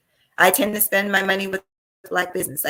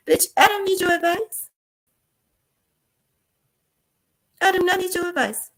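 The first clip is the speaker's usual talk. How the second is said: high, sassy put-down; sassy retort; 9 semitones higher than usual. Real, not acted. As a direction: sneer it off, high.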